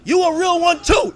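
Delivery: high, loud shouted callout; calling out. Pretend. Streamer calling out [name], loud shouting. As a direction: shout across at someone who isn't there.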